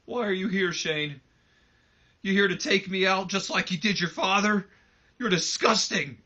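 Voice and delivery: high, loud, disgusted accusation; angry Mick Foley. Retold or imitated. Mick confronting Shane after the attack on Vince, angry and disgusted. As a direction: spit it out at the man who betrayed his own father. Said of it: "Mick just looks at him and he goes".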